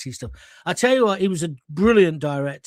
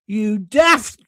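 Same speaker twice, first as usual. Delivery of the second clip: exasperated director; 3 semitones higher, 6 dB louder than usual. Retold or imitated. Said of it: "he goes".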